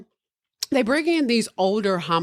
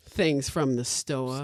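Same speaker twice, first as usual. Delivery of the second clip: mumbled mock answer; 5 semitones lower, 5 dB quieter, slower than usual. Mock answer, slow and low.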